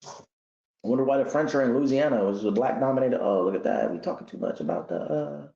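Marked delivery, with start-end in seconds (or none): none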